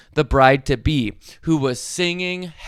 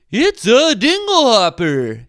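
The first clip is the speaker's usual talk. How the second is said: lightly voiced Scuttle, sure of himself; a know-it-all bird voice, stated firmly; certain seagull; 6 semitones higher than usual. A narrator's reading. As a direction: Scuttle the Seagull telling Ariel what it is, with certainty. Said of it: "he said with certainty".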